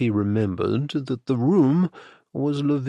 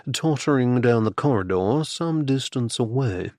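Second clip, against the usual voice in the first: ordinary delivery, nothing marked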